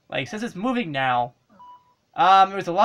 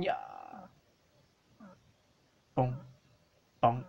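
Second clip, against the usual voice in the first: normal talking voice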